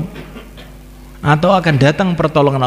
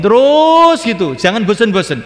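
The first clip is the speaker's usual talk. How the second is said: high, loud, slow push; preacher insisting, raised high; emphatic urging; 9 semitones higher, 3 dB louder than usual. Acted. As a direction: drive it home urgently. Urging persistence.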